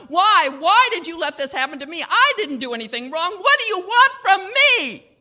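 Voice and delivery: high, loud anguished wail to God. A narrator's reading. Girl wailing to God alone on her bed. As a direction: cry out to God in despair. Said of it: "she wailed to God".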